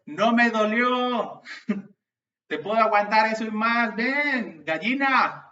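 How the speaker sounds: loud, high, slow cocky taunting; mocking taunt. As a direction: goad the bully, daring him. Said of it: "Y de repente yo otra vez". (burla desafiante, fuerte y lenta)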